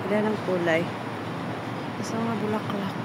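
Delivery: breathless from the climb; panting softly between words; out of breath. Real, not acted. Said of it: "Hinihingal akong mag, ano, umakyat".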